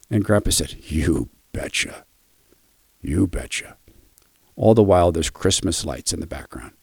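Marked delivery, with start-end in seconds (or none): 0.9-2.0 put-on lower, softer and slow, an old man's few words; a hint of a frail grandpa barely getting it out; imitating frail grandpa. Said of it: "The tone kept changing". 3.0-3.8 barely whispered "You betcha"; whispered grandpa. Retold or imitated. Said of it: "The tone kept changing".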